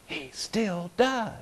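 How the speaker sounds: soft, slow confiding aside; preacher confiding a dark secret, hushed and slow. Real, not acted. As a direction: let the secret drop almost unheard. The dirty little secret, confided.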